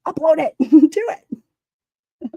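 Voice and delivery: high-pitched little urging voice; squeaky-high mock insistence; mock self-command. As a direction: playfully imitate yourself giving the order. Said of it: "I'm like".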